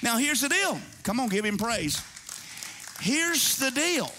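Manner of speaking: full-force Sunday preaching. Acted.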